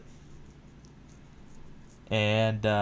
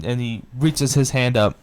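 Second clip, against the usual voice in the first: ordinary delivery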